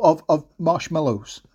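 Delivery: usual speaking voice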